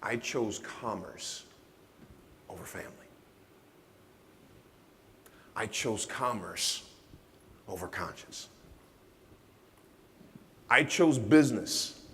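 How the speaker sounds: slow, heavy, hushed admission; slow solemn confession. Acted. Speaker confessing his mistakes to the audience, slow and solemn. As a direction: let each confession sink in slowly.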